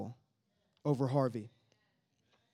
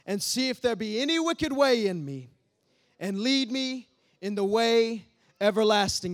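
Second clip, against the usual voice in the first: fervent prayer; 3 semitones higher, 4 dB louder than usual. Acted. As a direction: plead the psalm up to God. Prayer read aloud.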